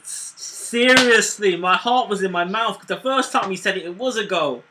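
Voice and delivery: ordinary talk, nothing marked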